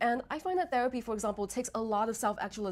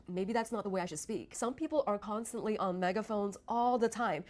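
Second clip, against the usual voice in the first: usual speaking voice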